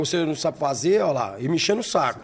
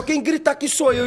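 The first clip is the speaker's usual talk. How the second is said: imitating the screaming chef, pitched up; retold yell; 7 semitones higher than usual. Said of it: "começou a gritar que nem um louco". (imitando o grito do cozinheiro, agudo)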